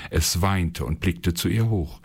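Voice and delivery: ordinary delivery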